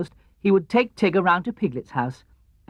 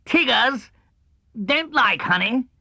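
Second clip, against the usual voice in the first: firm, higher Tigger verdict, slow on the last words; decided; 7 semitones higher, slower than usual. A narrator's reading. Tigger tastes the honey and decides firmly. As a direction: state it as a final ruling. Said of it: "in a very decided voice".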